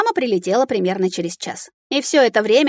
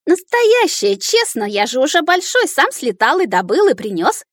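high-pitched eager little dragon; insistent childish babble, starting high and settling; gushing child chatter; 6 semitones higher, 3 dB louder than usual. A narrator's reading. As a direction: tumble over the words like an excited kid. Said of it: "захлёбываясь словами, тараторил Кирюша".

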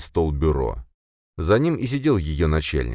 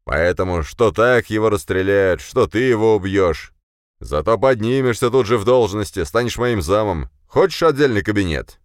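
higher, louder forceful persuasion; shouting; 5 semitones higher, 5 dB louder than usual. A narrator's reading. Boss offers promotion for a killing, shouting. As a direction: press the offer in a raised voice. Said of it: "а затем перешёл на крик".

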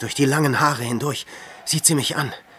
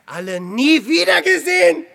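high, much louder cry of loss; anguished cry; 10 dB louder than usual. Acted. Hermit crying out that he never saw his family again. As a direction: wail it out, grief breaking through.

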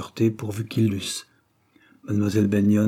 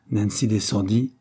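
ordinary delivery

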